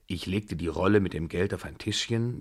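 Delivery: usual speaking voice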